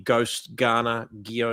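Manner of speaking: Ghost, Ghana, Gionis, each opened with a hard G; word-by-word lesson on the hard G of Gionis. Shown as a demonstration. Guest giving host an English lesson, hard-G demonstration. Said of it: "A G and an H is a hard G".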